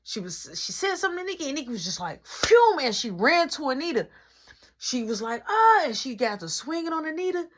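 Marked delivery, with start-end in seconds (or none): 2.2-2.8 quick rushing phew, high and loud; a sudden zipping sound of someone dashing off; whoosh sound. Retold or imitated. Sister bolting at Anita, a high whoosh. 5.5-5.8 short high yell, louder; a sharp cry as she charges in; battle yell. Retold or imitated. Sister charging at Anita, a short high yell. Nikki yelling as she swings.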